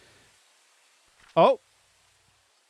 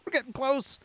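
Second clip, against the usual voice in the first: high, soft excited singsong; excitedly, pitched up but kept soft; giddy, hushed and high; 9 semitones higher, 6 dB quieter than usual. Real, not acted. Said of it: "I'm getting excited".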